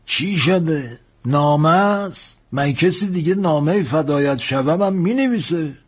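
high-voiced old shipmate ribbing a young sailor; old engineer, teasing. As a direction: ask it with drawn-out, teasing curiosity.

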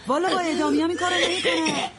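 high, agitated scolding. Acted. Old neighbor scolding the daughter to fetch water, agitated. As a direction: snap at her to fetch water.

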